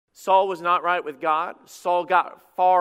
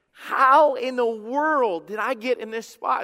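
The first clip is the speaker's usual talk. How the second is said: higher, slow, stunned self-question; bewildered disbelief; 7 semitones higher, 5 dB louder, slower than usual. Retold or imitated. Preacher voicing a drifted believer, slow stunned disbelief. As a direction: ask yourself how you ended up here, aghast.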